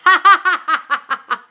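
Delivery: loud, high burst of laughter. Real, not acted. Reading a viewer's cheeky comment, laughing loudly.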